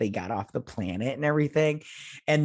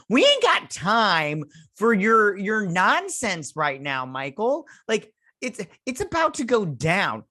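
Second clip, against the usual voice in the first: mock exasperated; 7 semitones higher, slower than usual. Viewer snapping at a crewmate over feelings, mock exasperated. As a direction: scold him for wasting time, dragging it out. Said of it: "And you're all like".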